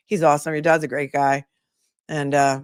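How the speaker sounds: ordinary delivery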